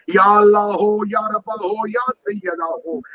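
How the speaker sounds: high devotional call. Shown as a demonstration. Preacher showing the worshipper's prostration call, high then fading. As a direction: call out then let it fade.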